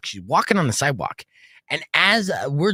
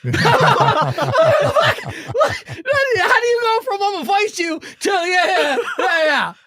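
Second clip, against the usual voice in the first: much higher, louder, shouted through laughter; laughing disbelief; 5 dB louder, much slower than usual. Real, not acted. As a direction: cackle in disbelief at the reversal. Laughing at Nick's reversal.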